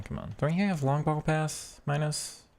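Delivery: ordinary delivery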